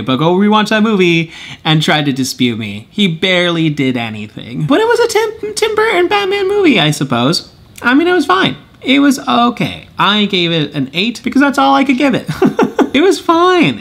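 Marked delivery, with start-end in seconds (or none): 4.7-13.8 high-pitched. Real, not acted. Half-hearted verdict on Batman Returns.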